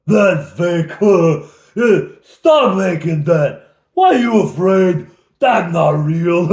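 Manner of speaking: loud, high, slow dim-witted ogre voice with laughs; dumb ogre. Acted. Ogre scoffing at the illusion dragon, loud and slow. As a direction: bellow it like a brainless brute. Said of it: "He's so stupid".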